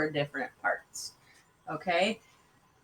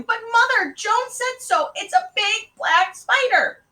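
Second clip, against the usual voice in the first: put-on high, loud child's squeal; frightened little girl protesting at top pitch; 6 dB louder than usual. A narrator's reading. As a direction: squeal in panic to a doubting mother.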